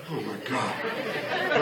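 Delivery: slow, low mock exhaustion; drained. Mentor left drained by the team, hammed-up exhaustion. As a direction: sag as if the life were sucked out. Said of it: "sucking life out of you".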